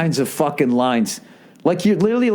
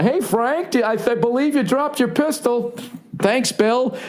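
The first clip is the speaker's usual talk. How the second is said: much higher, polite cul-de-sac neighbor voices; suburban dads; 10 semitones higher than usual. Acted. Comic imitating polite suburban neighbors over a dropped pistol, chirpy and high. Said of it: "Just classic cul-de-sac".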